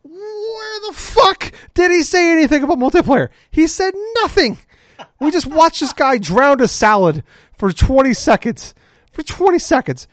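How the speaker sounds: high-pitched, incredulous outburst; real exasperation, voice shooting way up; exasperated shouting. Real, not acted. Host ranting to co-host about empty answer, exasperated shouting.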